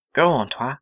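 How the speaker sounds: ordinary delivery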